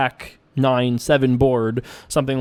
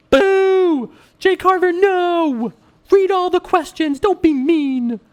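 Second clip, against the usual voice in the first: shrill cartoon heckler, much higher; squeaky booing fan; slower than usual. Imagined viewer booing the streamer, very high squeaky voice. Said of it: "I don't know what voice that was".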